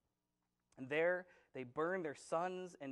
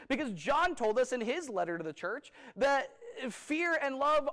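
louder, higher fervent sermon delivery; preaching at full force; 7 semitones higher, 8 dB louder than usual. Acted. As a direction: drive the point home from the pulpit. Preaching fear versus love.

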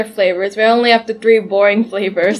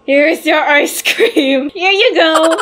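slow, beaming and higher; big smile voice; 5 semitones higher, slower than usual. Shown as a demonstration. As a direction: serve it with an exaggerated grin. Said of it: "my smile's not big enough".

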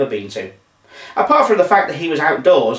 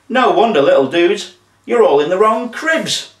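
high, louder laid-back dude voice; zombie dude; 6 semitones higher, 4 dB louder than usual. A narrator's reading. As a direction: play a cheerful, cool zombie. Doug the dude zombie speaks.

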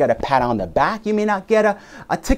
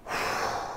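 big weary sigh; 12 dB quieter than usual. Shown as a demonstration.

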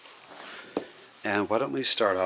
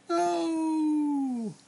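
very high, long drawn-out wail; mock-anguished squeak; horrified no. Reacts to the mum's photo plan.

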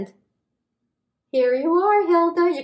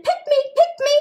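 high little pleading voice; eager umbrellas; 14 semitones higher, faster than usual. Acted. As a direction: beg to be picked, high-pitched. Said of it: "umbrellas saying".

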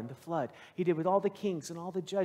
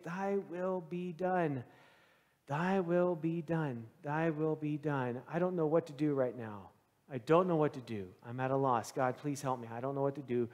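quiet desperate prayer; 5 semitones lower, 5 dB quieter than usual. Retold or imitated. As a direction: murmur a prayer in despair. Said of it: "I found myself saying".

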